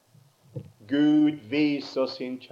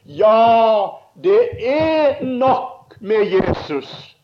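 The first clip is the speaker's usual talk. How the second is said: very loud, high, very slow drawn-out shout; calling out; 9 semitones higher, 10 dB louder, much slower than usual. Retold or imitated. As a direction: stretch the words out across the sea. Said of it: "Så roper hun til han".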